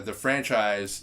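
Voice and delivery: usual speaking voice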